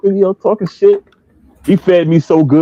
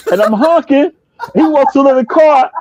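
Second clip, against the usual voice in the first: ordinary delivery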